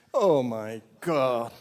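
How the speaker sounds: very slow, stretched Oh my God; drawn-out exasperation. Acted. Father after daughter hangs up, drawn-out mock despair. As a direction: drag out each word in mock despair.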